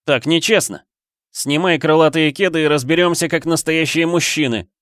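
high, indignant demand. A narrator's reading. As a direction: insist hotly on a fair fight. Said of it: "потребовал Флинн". (возмущённо, требовательно)

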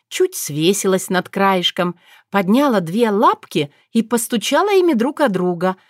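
plain reading voice

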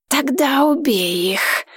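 slow, silky coaxing dragon; insinuating dragon. Acted. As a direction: coax like a sly dragon. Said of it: "вкрадчиво произнёс дракон".

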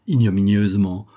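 plain reading voice

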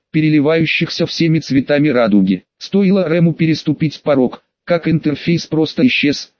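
usual speaking voice

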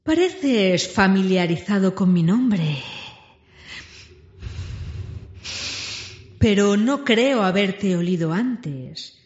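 slow, probing dragon question. A narrator's reading. Smaug questions Bilbo.

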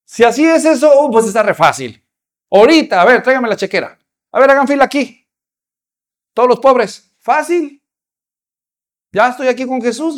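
breezy, overjoyed yes-man; very happy. Acted. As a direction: act out the man jumping at an easy deal. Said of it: "se puso muy feliz y dijo".

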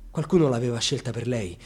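usual speaking voice

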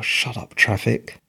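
ordinary talk, nothing marked

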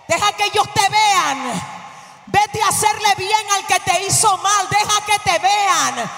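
full-force, exultant preaching shout; fervent preaching. Real, not acted. Preacher urges congregation to be seen by their oppressors, fervent. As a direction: proclaim it to the whole hall without letting up.

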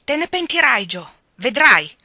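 loud, high, menacing yell; threatening shout. Acted. Amy shouting a threat after Jo from the stairs. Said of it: "gridò minacciosa".

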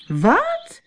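high startled exclamation; mild mock outcry of disbelief; shocked cry. A narrator's reading. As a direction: react as if she can't believe it. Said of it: "riep Barbie".

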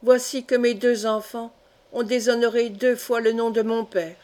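plain reading voice

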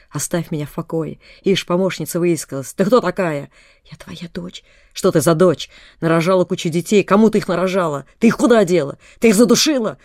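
building from firm to loud accusing shouts. A narrator's reading. Delirious mother accusing her daughter, building to furious shouting. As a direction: rise into an unnatural tirade. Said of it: "всё громче и всё неестественнее говорила мать".

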